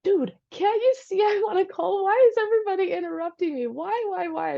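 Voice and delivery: high, exasperated retort to husband; acted-out fed-up complaint; frustrated. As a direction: plead why in rising frustration. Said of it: "It's so frustrating".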